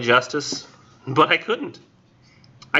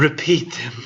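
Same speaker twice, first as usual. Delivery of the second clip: heartbroken murmur; 3 semitones higher than usual. Acted. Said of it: "she murmured in a heartbroken tone".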